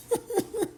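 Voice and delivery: short quiet chuckle; a quick breathy laugh; a brief amused snicker; soft laugh. Real, not acted. Laughs at own retelling.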